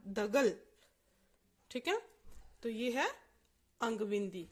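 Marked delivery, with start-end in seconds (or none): 0.1-0.5 दगल said da-gal with no ng sound; without the nasal. Shown as a demonstration. Teacher shows the word without the bindi. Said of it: "अंग बिंदी नहीं होती तो ये बनता".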